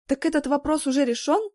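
колкий вопрос с подковыркой; съязвить с насмешкой; язвительно. A narrator's reading. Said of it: "Не смогла не съязвить". (язвительно)

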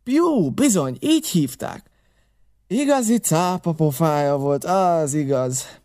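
high, emphatic recognition; excited exclamation. A narrator's reading. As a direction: cry the name out eagerly. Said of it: "kiáltott föl Silver kissé izgatottan".

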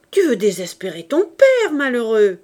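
loud, anguished reproach; mother scolding her rebellious son, loud and pained; reproachful cry. Acted.

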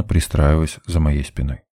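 plain reading voice

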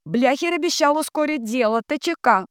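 very high chanted relay; high-pitched echoing drone; entranced telegraph operator. A narrator's reading. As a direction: transmit the last line up high. Dunya echoes the telegram.

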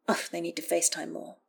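plain reading voice

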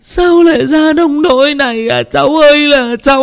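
keen over the dead nephew; aunt mourning her nephew, wailing; wailing lament. Acted. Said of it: "cũng thảng thốt".

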